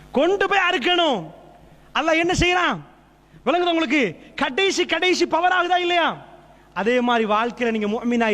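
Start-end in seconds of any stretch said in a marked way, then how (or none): 0.1-6.1 high, impassioned sermon voice; fiery preaching. Acted. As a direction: preach at full force, pleading with the crowd. Preaching climax.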